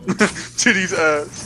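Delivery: slow laughing impression of Critical; slow it down, laughing, like the streamer; imitating Critical. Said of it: "It's Critical".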